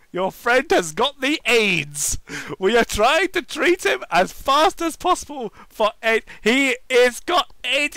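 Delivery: hammed-up bad news; dramatic nurse.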